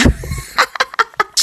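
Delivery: high, soft run of giggles; high bubbling laughter; amused laugh. Real, not acted. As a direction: giggle at own slip in a high pitch. Laughs at own word slip.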